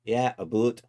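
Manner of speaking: put-on joking stock Canadian accent; lightly hammed-up Canadian "aboot"; mock Canadian. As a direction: play at a Canadian accent, loud and brief. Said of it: "That's all the Canadian I know".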